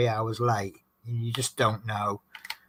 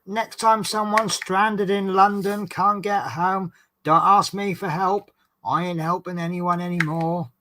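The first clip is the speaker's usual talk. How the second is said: much higher, exasperated mock rant; retold lost temper; 7 semitones higher than usual. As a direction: re-enact losing patience with a passenger. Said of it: "I actually lost my temper".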